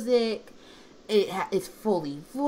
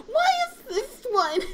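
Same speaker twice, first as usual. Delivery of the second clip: high-pitched, drawn-out incredulous question; mock bafflement; 3 dB louder than usual. Host asking why the kiss scene is most popular, mock bafflement. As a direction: squeal it slowly in exaggerated disbelief.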